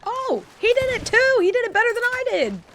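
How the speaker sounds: high, loud, delighted shrieking; excited shriek. Real, not acted. Amazed player shrieking at the enemy's blunder. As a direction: squeal it out in disbelief. Enemy blows up own boat.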